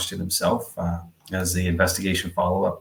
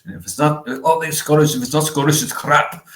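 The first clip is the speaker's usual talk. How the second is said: mock Scottish accent, higher and louder, hammed-up; bad Scottish accent; 8 semitones higher, 5 dB louder than usual. Quoting an old Saturday Night Live skit, in a hammed-up Scottish accent. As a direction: ham up a cheesy comedy-sketch Scottish brogue. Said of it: "worst imitation accent I could ever".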